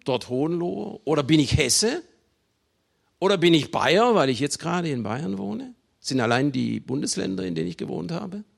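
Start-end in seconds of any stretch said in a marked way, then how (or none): none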